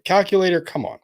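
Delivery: loud voice command; calling out. Real, not acted. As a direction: call out to the calculator.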